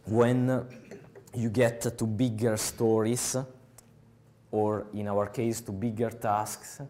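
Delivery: ordinary talk, nothing marked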